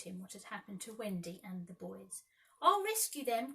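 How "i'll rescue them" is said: high, loud resolve; heroic cry. A narrator's reading. Peter vowing to rescue Wendy, high and loud. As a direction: call out boldly. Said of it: "cried Peter".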